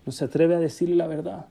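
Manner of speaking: normal talking voice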